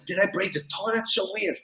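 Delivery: hushed, puzzled murmur to himself; quiet musing. Retold or imitated. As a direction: mutter it half-asleep, confused. Groggy self-quote waking after drinking.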